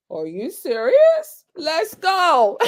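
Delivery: drawn-out question into loud cheer; slow incredulous build to a whoop; thrilled disbelief. Retold or imitated. Reacting to top agent offer.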